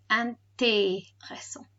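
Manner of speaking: slow "Intéressant" with a clear "ay" for é. Shown as a demonstration. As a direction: break Intéressant into syllables, dwell on the "ay". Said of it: "it makes an A sound".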